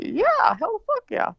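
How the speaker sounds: high-pitched, cocky enthusiasm; eager acceptance. Retold or imitated. Drunk partygoer saying yes to strangers, high and eager. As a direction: jump at the offer, voice way up.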